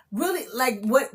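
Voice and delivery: ordinary talk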